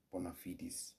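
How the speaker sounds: "bona fides" with fides as two syllables, "FEE-dis"; "bona fides" with a long ee and a final s sound; alternative pronunciation. Shown as a demonstration. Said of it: "can be pronounced as".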